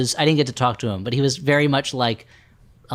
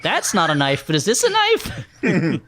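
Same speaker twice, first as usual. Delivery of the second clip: mock Australian knife line; 3 semitones higher, much slower than usual. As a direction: play a swaggering Aussie with a knife. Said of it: "kept asking people if things were knives".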